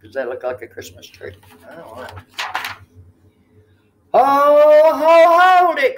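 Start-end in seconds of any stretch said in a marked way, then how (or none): 4.1-6.0 loud, high cry of hold it; elf crying out. A narrator's reading. Elf shouting at the disguised turkey, loud and high. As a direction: stop the turkey with a sharp call. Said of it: "cried the elf".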